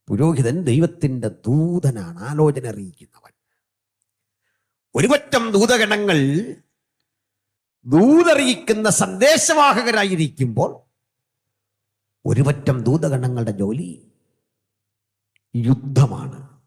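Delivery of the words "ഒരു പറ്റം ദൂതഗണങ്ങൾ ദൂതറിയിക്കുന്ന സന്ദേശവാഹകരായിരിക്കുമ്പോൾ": high and loud, proclaimed; ringing sermon climax; full-force preaching. Shown as a demonstration. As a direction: lift the whole congregation with it.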